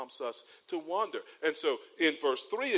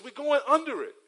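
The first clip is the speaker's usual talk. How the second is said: put-on high, loud child's discovery; excited little boy; 9 semitones higher, 8 dB louder than usual. Son in the back seat spotting a bridge, high and excited. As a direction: blurt it out like a thrilled three-year-old. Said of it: "he says".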